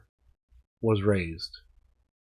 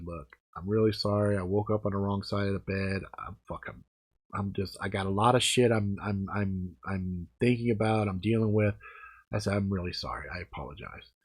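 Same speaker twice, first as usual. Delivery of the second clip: much softer, contrite sorry; soft apology; 2 semitones lower, 9 dB quieter than usual. Retold or imitated. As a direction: apologize gently, regretting it. Apology to wife.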